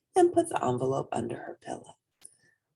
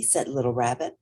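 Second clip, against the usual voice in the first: ordinary delivery, nothing marked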